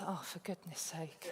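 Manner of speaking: low, soft grumble to oneself; retold inward groan, dropped well under the voice; muttered exasperation. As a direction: imitate a private, exasperated thought. Retelling own exasperated first thought.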